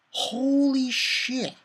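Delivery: high, long drawn-out awe; retold amazement. Fan retells reaction to old man's transformation, drawn-out amazement. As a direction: stretch both words out high in mock shock.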